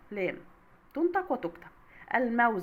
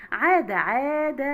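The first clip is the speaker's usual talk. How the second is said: louder, slow عاد with a held "aa"; long ā vowel; 5 dB louder, much slower than usual. Shown as a demonstration. Teacher showing madd with alif, عاد with stretched ā. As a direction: draw out the ā in عاد slowly. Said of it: "مد بالألف".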